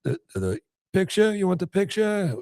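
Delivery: surprised high voice. Retold or imitated. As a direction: play baffled at being asked. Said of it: "and he's like".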